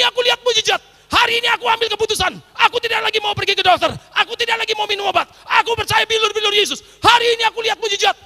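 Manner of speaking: very high, loud jubilant cries of miracle; ecstatic shouting. Retold or imitated. Father shouting with joy after his daughter revives, high and loud.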